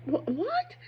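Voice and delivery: high, stunned double-take; mock disbelief, voice jumping way up; a sudden high startled stammer; baffled what. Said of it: "things that make you go".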